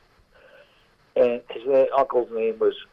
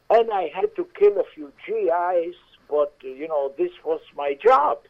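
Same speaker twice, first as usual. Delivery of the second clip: put-on boastful German accent, pitched high; 7 semitones higher than usual. Maitre d' bragging about rescuing Mussolini, German accent. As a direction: brag about the war in a German voice. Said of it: "with an accent".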